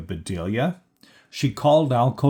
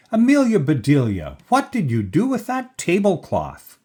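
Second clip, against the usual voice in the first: raised, high-pitched demand for an explanation; exasperated scolding; 7 semitones higher, 3 dB louder than usual. A narrator's reading. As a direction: confront the maid over a mishap. Upset employer questions maid about tablecloth.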